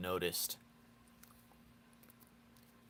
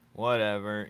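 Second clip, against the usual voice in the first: ordinary delivery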